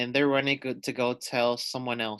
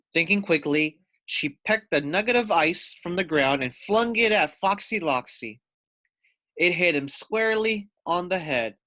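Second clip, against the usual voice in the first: usual speaking voice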